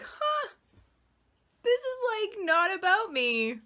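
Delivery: a lightbulb moment, voiced high; sudden realization. Imagined self realizing something, high surprised insight. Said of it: "you'll be like".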